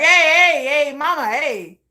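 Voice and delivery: loud, high street holler; catcalling. Acted. Dude hollering at a woman walking down the street, catcalling. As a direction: call out to grab a stranger's attention.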